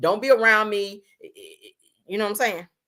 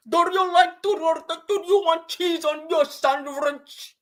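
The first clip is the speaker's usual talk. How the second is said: hammed-up Subway worker imitation; mocking the Subway worker asking about cheese; mock sandwich clerk accent; 10 semitones higher, much slower than usual. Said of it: "The motherfuckers that own Subway now".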